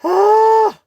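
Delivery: a sharp high piercing yelp, loud; sudden high yelp. Real, not acted. Yelping loud while chatting on the live stream. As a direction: let out a high loud cry of surprise.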